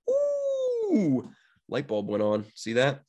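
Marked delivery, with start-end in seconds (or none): 0.1-1.3 very high, held "ooh". Pretend. Host as the light bulb goes on, high "ooh". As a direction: squeal a long, playful "ooh".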